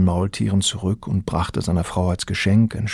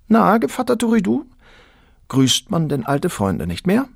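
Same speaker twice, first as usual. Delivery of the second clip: high, coy woman's voice, much higher; teasing Lola; 10 semitones higher than usual. A narrator's reading. Lola teasing her old sweetheart Turiddu, coy and high. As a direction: flirt with him, teasing. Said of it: "antwortete Lola".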